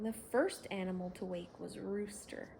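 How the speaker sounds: usual speaking voice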